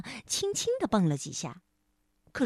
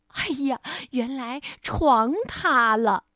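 usual speaking voice

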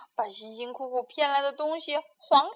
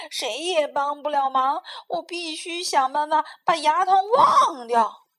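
through clenched teeth; 4 semitones higher, 10 dB louder than usual. Acted. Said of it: "花袜子呲牙咧嘴地说".

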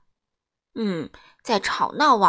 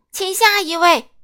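loud, high bellow; shouting grocer; 9 semitones higher, 10 dB louder than usual. A narrator's reading. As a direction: bellow that you can't hear. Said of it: "杂货店老板大喊". (杂货店老板高声大喊)

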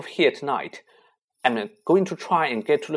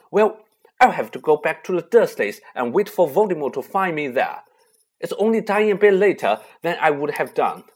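higher and louder, urgent and fierce; impassioned outburst; 7 semitones higher, 9 dB louder than usual. A narrator's reading. Harry insisting to his friends he must go: fierce outburst. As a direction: pour out desperate anger.

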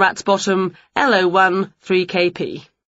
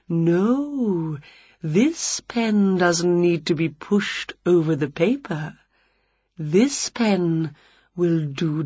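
smarmy vampire; 3 dB quieter, slower than usual. A narrator's reading. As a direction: purr it out like a smooth salesman vampire. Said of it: "A smarmy vampire".

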